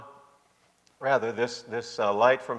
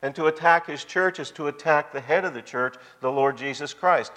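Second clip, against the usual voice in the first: ordinary talk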